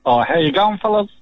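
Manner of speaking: New Zealand accent. Real, not acted. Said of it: "I detect a little bit of a New Zealand accent there".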